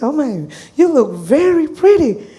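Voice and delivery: high, loud admiring exclamation; gushing compliment. Retold or imitated. Therapist complimenting a client on her looks, gushing.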